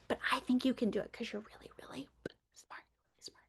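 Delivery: soft, fast whisper of praise. Shown as a demonstration. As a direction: lean in and whisper it like a secret. Teacher praises kids softly.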